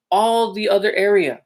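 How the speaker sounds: normal talking voice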